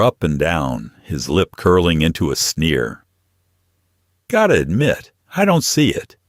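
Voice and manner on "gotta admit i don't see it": sneering. Acted. Thug sneers at Naomi.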